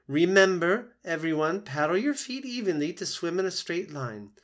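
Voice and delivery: high, loud, bright swim-lesson call; rallying the goslings for the lesson; old goose Loudwing, cheerful teacher. Acted.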